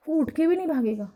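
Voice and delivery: ordinary delivery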